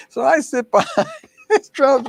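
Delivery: high, giggling through the words; delighted laughter over the story; laughing. Real, not acted. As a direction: laugh it out, barely holding it together. Laughs recalling sitting at drums.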